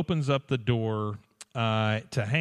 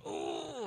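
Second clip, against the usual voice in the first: mock groan; 12 semitones lower, 13 dB quieter than usual. [name] pretends heartbreak.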